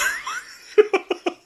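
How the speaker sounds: real high-pitched laugh; high giggle. Real, not acted. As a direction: let the giggle escape. Laughing at the reading.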